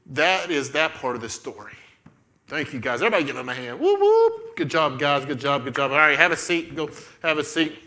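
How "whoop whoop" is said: two very high whoops; cheering whoops. Real, not acted. Leader cheering the volunteers after the skit, high whoops. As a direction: whoop it up in falsetto.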